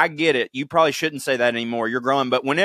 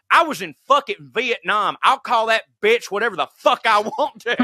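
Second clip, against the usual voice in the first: higher-pitched, defiant Vietnam vet retort; imitated loud outburst; 8 semitones higher than usual. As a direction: act out the veteran loudly refusing to be hushed. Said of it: "as loud, as loud as he could".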